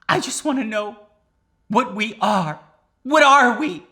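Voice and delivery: high, loud mock-tearful plea; imitated crying. Crying girlfriend asks boyfriend what they are, mock-tearful. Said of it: "She's still crying".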